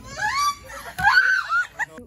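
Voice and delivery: high screaming laugh; shrieking laughter. Real, not acted. Laughing loudly again.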